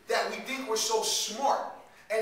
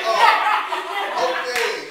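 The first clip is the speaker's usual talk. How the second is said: hammed-up shock, then play along; startled cover-up; 12 semitones higher, 13 dB louder, much slower than usual. Acted shock at seeing dad there.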